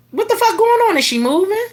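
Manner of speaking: stunned at the pile of bags; shocked disbelief. Retold or imitated.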